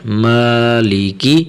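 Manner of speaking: مالی with "maa" held one alif long; stretched long vowel. Shown as a demonstration. Teacher showing the amount of one alif, "maa" stretched in مالی. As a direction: draw out the first vowel of مالی. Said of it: "ایک الف کی مقدار".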